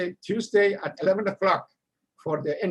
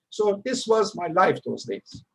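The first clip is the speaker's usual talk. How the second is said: ordinary talk, nothing marked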